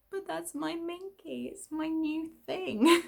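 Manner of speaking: put-on high, slow, pouty wail of a wronged child; mock whiny protest. To her husband who used her Minky, high pouty whine. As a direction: whimper it like a hurt kid, slowly.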